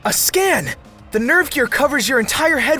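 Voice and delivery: sudden loud realization; excited. Acted. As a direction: blurt out the realization.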